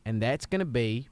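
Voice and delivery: mild Southern coach voice, easygoing; a Southern football coach giving a rousing talk; Southern accent. Acted. Coach telling the kid about walkout songs, in a mild Southern accent. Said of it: "let's just both be Southern".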